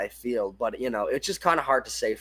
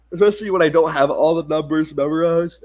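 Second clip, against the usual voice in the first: talking through a yawn; words stretched by a yawn; 5 dB louder than usual. Real, not acted. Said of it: "and I'm yawning".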